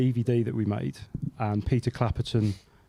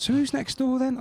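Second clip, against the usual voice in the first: voicing Scott; 13 semitones higher than usual. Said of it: "He said".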